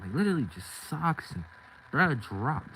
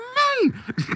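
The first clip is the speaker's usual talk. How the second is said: laugh; 9 dB louder than usual. Real, not acted. Laughing at own story.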